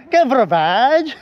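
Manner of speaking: slow, hammed-up French question; mock French cashier. Joking cashier asking a customer, mock French accent. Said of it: "Try ko kayo take order ng ganun".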